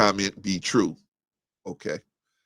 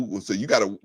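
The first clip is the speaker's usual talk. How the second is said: ordinary talk, nothing marked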